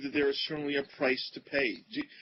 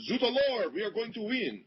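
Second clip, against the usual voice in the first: hammed-up French accent, pitched up high; mock French accent; 8 semitones higher than usual. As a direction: play a cartoon French fan with a French accent. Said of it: "that was my French accent".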